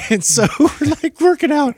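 high-pitched, laughing through the words. Real, not acted. Trainer recalls a workout story, laughing. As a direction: let laughter push the voice right up.

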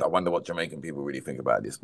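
low, quick mock commenter voice; mimicking online commenters. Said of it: "You can leave the million comments".

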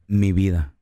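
sweet lover voice. Retold or imitated. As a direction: croon it to a lover. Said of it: "hasta te cambia la voz".

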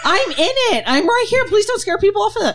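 squeaky protesting actress, high and laughing; high mock actress voice. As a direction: play her up high, offended and giggling. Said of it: "she's like".

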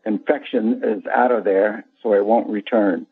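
normal talking voice

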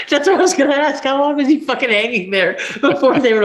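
laughing. Real, not acted. Host laughs asking about the upside-down rapper, giggling. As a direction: crack up while asking.

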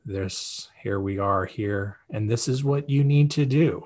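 mock boring presenter reading verbatim; boring slide reading. Shown as a demonstration. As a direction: drone through the slides like a dull webinar. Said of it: "but that's boring".

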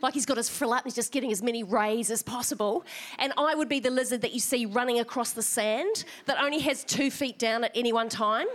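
ordinary delivery

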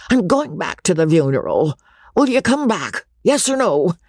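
demanding character voice, climbing to the final question; insistent old baronet. A narrator's reading. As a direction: badger her for an answer. Sir Pitt presses Rebecca to return.

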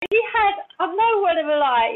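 high, eager build-up; excited insistence. Real, not acted. She insists to the host about the dragons, high and eager. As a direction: lean in, bursting to tell.

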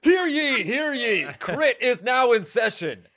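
booming court crier call; town crier. Acted. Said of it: "Can you give us a hear ye".

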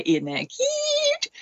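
high, drawn-out mock coo; cooing mother. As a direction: imitate a mother indulging her child, high and slow. Voicing mother cooing at child.

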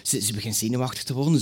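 usual speaking voice

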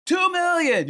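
high, loud retold yell; yelled bid. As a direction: shout it out across an auction room. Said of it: "I just yell it out".

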